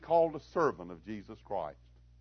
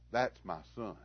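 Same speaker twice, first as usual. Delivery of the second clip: quiet pride; 5 semitones lower, 8 dB quieter than usual. Retold or imitated. Father quietly proud of son.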